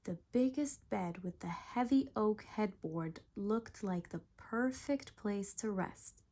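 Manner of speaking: ordinary delivery, nothing marked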